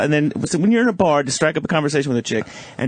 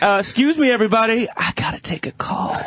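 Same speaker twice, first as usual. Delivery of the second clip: pompous announcement; 7 semitones higher than usual. Retold or imitated. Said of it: "And you were like".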